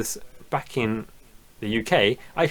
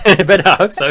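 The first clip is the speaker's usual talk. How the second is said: laughing; 8 semitones higher, 10 dB louder than usual. Real, not acted. Laughing at own retort.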